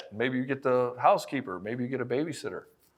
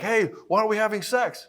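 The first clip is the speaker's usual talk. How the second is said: frustrated demand; 8 semitones higher, 5 dB louder than usual. Retold or imitated. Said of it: "You're like".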